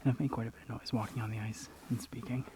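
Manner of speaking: hushed whisper, sneaking up on wildlife. Real, not acted. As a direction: whisper so the bird stays put. Sneaking close to feeding eagle.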